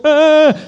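loud, high startled cry; mock fearful yell. Preacher mimicking constant worry, loud startled yell. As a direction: yell as if something jumped out. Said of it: "always worried something's gonna go".

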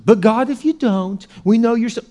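mock backpedalling, pitched high; hedging prayer. Believer prays then gives God an out, hedging. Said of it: "You know what most of us do?".

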